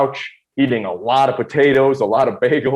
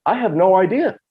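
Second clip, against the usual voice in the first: retold bewilderment; 8 semitones higher than usual. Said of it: "And I go".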